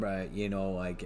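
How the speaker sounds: ordinary talk, nothing marked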